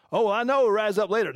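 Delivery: dismissive quick reply. Retold or imitated. Imitating Martha answering Jesus about her brother, quick and dismissive.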